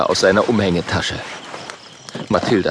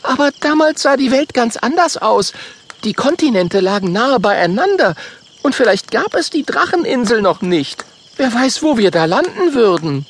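higher, louder warning voice; worried Mathilda; 13 semitones higher, 3 dB louder than usual. Acted. Mathilda warns about the ancient world, higher worried voice. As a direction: warn them anxiously.

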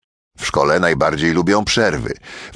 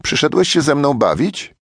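put-on higher, hopeful young girl asking; hopeful little girl; 7 semitones higher than usual. A narrator's reading. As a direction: ask like a lonely girl hoping for a friend. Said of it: "dziewczynka zapytała z nadzieją".

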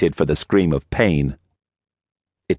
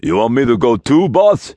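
loud rising question; eager big man asking; giant voice; 7 semitones higher, 4 dB louder than usual. A narrator's reading. Giant asks boss.

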